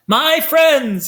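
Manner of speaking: high, loud showman's greeting; clean, clear announcer voice. A narrator's reading. Salesman greeting the sneetches, clear and keen. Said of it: "he announced in a voice clean and clear and keen".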